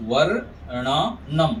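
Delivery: वर्णनम very slow, in separate syllables; rolled रेफ demo. Shown as a demonstration. Teacher repeats वर्णनम for students, slow syllable demo. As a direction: hold a rolled र in वर्णनम before ण. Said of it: "ऐसे उच्चारण करेंगे".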